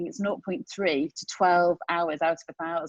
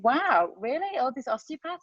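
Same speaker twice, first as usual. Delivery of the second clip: high, mock-amazed wow; mock surprise; 7 semitones higher than usual. Recalling misreading the slide, mock surprised. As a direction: feigns amazement, pitched up. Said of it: "And I thought".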